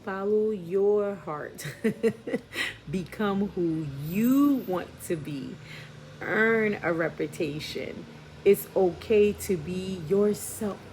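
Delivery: slow, loud, emphatic card reading. Real, not acted. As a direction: proclaim each message to the viewers. Reading tarot card messages.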